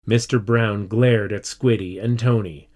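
ordinary delivery, nothing marked

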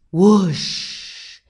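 loud whoosh with long hushing tail; imitated whoosh. A narrator's reading. King swinging on the wheel, imitated whoosh. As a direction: blow the word out like rushing air.

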